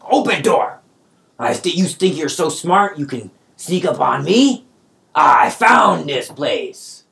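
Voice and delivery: high, loud bossy goblin bark; bully them into opening the door; goblin sumo demand. Acted. Sumo goblin Krieg ordering captives, loud and high.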